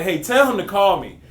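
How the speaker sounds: loud, high mock demand. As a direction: bark it like an annoyed client. Retold client demand.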